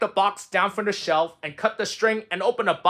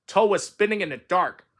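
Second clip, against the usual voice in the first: ordinary delivery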